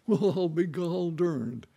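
a hammed-up country farmer amazed; astonished farmer. Farmer reacting to the pump organ, hammed-up rustic amazement.

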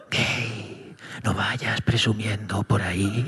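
a sly inner voice teasing him low and soft. Pretend. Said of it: "escuché esa voz".

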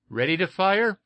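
high, loud hail up the hatch; hailing call. A narrator's reading. Jack calls up to Eph, a loud high hail. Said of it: "Jack hailed".

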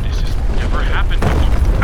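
loud, high alarmed shout. Acted. Time traveller shouts over the collapsing room. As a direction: yell it over the crashing room.

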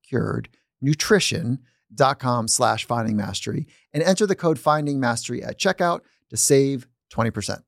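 upbeat sponsor read, spelling out the URL. Acted. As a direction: read the promo code like an ad.

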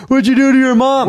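high, loud angry dad; dad yelling. Retold or imitated. Dad bursts in yelling at his son. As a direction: yell it like a furious father. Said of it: "here comes my bitch ass dad running in".

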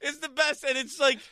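high-pitched giggling through the words; can't stop laughing at the news. Real, not acted. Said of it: "I don't know why I'm laughing so much".

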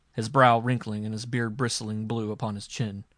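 ordinary delivery

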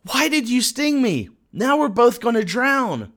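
high, pleading frog cry; a high panicked squeak of betrayal; desperate frog. Retold or imitated. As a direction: plead up high, sinking and scared. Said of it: "the frog looks up ... and says".

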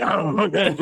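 mock monster voice through a laugh; imitating a friend as a monster. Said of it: "You sound like a monster".

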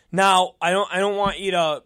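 ordinary talk, nothing marked

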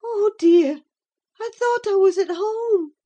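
high, loud plaintive sigh; wistful distress, pitched well up; sighing lament. A narrator's reading. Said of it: "she sighed".

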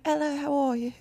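imitating a British greeting, lightly put on; British accent. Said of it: "No, you're not British".

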